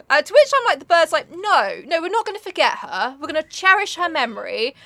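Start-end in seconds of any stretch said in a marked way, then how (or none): 1.3-4.7 a lightly voiced higher Raven, earnest and soothing; comforting Raven. Raven comforting the grieving narrator, in a lightly raised soothing voice. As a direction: play the bird as a pep-talking mate.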